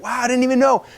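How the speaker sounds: high-pitched imitation of an amazed customer; retold amazement. Lady hearing it works for coaches, amazed. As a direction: play her sudden realization, pitched well up.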